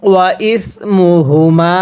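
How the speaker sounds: "ইসমুহুমা" slowly, with the ma held three alifs; long stretch. Shown as a demonstration. Teacher shows a three-alif stretch. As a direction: hold the long vowel of "ইসমুহুমা" for three counts. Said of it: "তিন আলিফ টান দেব".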